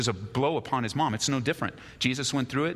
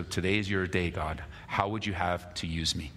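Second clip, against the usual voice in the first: prayer; 4 semitones lower than usual. Acted. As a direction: pray it humbly to God. Closing prayer over music.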